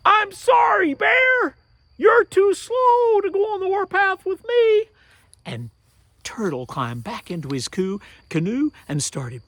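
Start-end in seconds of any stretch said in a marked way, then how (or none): 0.1-4.9 high, loud shout across the distance; shouted excuse. Acted. Turtle shouts to the bear far away, high loud shout.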